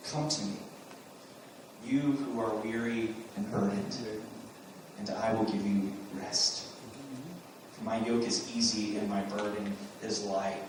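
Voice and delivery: soft, low, gentle invitation; tender invitation. Acted. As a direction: speak it softly, like comforting the weary. Jesus' gentle words quoted.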